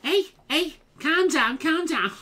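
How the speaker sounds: high-pitched caricature Scouse; fake Scouse accent. Joking catchphrase for the viewers, mock Scouse. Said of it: "I can't fucking do the Scouse accent".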